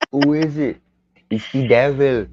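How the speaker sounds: slow, drawn mock Jamaican voice; a playful Jamaican welcome; Jamaican accent. Shown as a demonstration. Friend asked for Jamaican, a slow playful Jamaican accent. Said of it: "That's my Jamaican".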